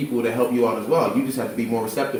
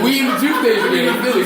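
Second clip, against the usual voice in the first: laughing; 9 semitones higher, 4 dB louder than usual. Real, not acted. Laughing about Juice days.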